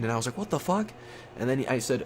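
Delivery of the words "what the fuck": high, stunned what the fuck; sudden pitch leap of disbelief; shocked disbelief. Retold or imitated. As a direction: cry out in shock at being overcharged. Shocked at friend's price news.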